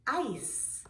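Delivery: "Ice" with a long, stretched I; drawn-out vowel. Shown as a demonstration. Teacher asking children to hear the I sound, drawn-out "Ice". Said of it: "Do you hear an I sound".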